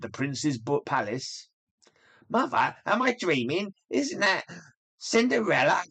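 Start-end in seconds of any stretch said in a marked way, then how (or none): 2.3-5.8 high, incredulous gasp; can't believe her eyes; shocked stepsister. Acted.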